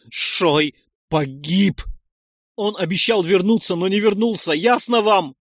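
anguished, furious shouting; despairing anger. A narrator's reading.

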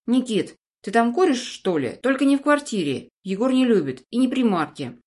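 high loud scolding mom; fussy mother. Acted. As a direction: nag him like a worried mother.

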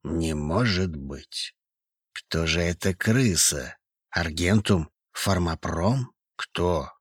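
very soft, slow, low shocked whisper; hushed disbelief, barely voiced. A narrator's reading. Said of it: "прошептал Трошин". (шёпотом, медленно)

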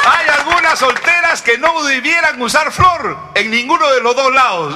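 high, loud comic jab; loud teasing punchline; punchline shout. Real, not acted. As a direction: shout the tag line for the laugh.

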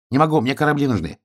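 high, hurried hedgehog voice; busy little hedgehog. Acted. Hedgehog declines wolf's tea, high and hurried. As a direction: turn him down quickly in a small high voice.

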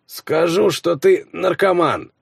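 high, slow snide jab; snide teasing. A narrator's reading. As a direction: tease him with a smirk. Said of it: "ехидное хихиканье".

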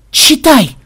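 loud, high, insistent cry; urgent command. A narrator's reading. Programmer pushing a printout at the captain, urgent command. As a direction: order it urgently, high and forceful.

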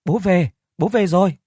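high, excited shout of joy; a boy cheering as dad gets home; cheering child. A narrator's reading. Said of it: "cũng đã reo lên".

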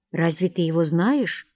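plain reading voice

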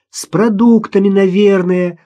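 put-on high, loud child calling; daughter's voice. A narrator's reading. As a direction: call back as a young daughter. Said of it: "услышала она голос дочери". (голос дочери, высоко и громко)

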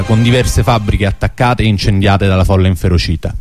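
ordinary talk

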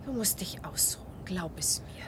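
breathy whisper, urging him to rest; soft worried whisper, almost voiceless; whispered plea. Acted. Franka quietly urges rest. (besorgt flüsternd)

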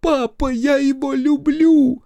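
high loud slow wail. Acted. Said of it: "Рёв невесты поднялся на октаву выше".

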